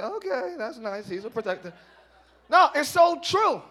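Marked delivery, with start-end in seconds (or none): 0.0-1.7 flat, quiet, underwhelmed reply; mock bored okay, softer and low; unimpressed. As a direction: say it like it barely lands. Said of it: "That's how I sounded when".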